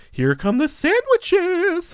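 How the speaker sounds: put-on high slow girlish quote; drawn-out little girl voice; quoting Alyssa Milano. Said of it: "when Alyssa Milano says".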